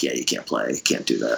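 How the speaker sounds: low, subdued landlord voice; imitated landlord. Landlord at the band's door about the noise, low imitated refusal. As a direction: drop low and soft for a casual refusal.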